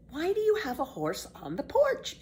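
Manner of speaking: put-on high, curious boy voice; young boy Tommy. Tommy asks Pippi about walking backward, puzzled boy voice. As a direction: ask it like a puzzled small boy.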